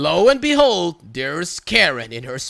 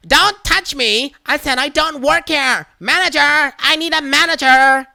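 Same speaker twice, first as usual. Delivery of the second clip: screaming Karen; 8 semitones higher, 6 dB louder than usual. Retold or imitated. Karen shrieking across the aisle for a manager, hammed-up panic. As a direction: yell for the manager in mock panic.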